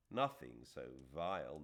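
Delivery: ordinary delivery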